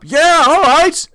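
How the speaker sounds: high, loud, slow cheer; crowd cheer. Acted. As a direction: cheer the fighter on.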